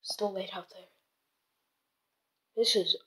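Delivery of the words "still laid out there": uneasy whisper. Real, not acted.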